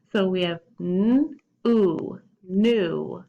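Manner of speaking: "n, oo, new" split into sounds then blended; sounding out new. Shown as a demonstration. Teacher sounding out "new" for kids, slow and clear. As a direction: stretch "oo" then join into "new". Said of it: "it's sounded out like n".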